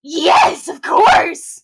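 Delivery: shouted, excited confirmation; loud eager yes. Acted. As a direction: belt it out with a crack. Accuser confirms formal accusation.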